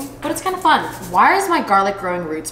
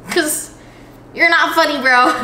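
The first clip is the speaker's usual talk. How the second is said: higher, teasing punchline voice; blunt mock retort; 7 semitones higher, 4 dB louder, slower than usual. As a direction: toss it off high and cheeky. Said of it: "And I'm like".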